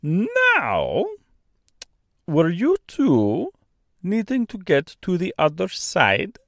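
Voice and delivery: put-on slow, thick Austrian-French accent; kindly bird in Austrian-French accent. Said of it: "strangely thick, almost Austrian accent".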